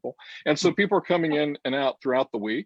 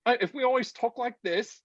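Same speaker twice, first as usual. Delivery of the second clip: put-on British accent, pitched higher; 9 semitones higher than usual. Shown as a demonstration. Host showing a co-host she can do the accent, British accent. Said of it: "Can you do the accent".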